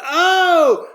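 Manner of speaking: high, loud "oh!"; elated shout. Acted. New hire reacting to the good news, elated shout. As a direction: burst out with a huge yell. Acting his reaction to being hired.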